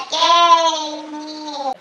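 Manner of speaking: high, drawn-out little "yay" cheer; mock celebration. New driver recalls passing the retest, mock celebration.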